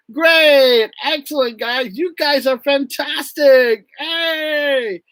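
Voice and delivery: high, drawn-out cheering; jubilant praise. Retold or imitated. Teacher cheering students for their answers, high and jubilant. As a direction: celebrate the class like they just won.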